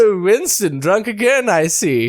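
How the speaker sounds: a hint of a prim scold; lady scolding Churchill. Retelling a Family Guy scene as the lady, mock scold. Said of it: "she was like".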